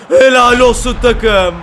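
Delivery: loud, slow, drawn-out praise for the team; excited cheer. Real, not acted. Player cheering his teammates, loud and slow. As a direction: roar it out like a fan celebrating.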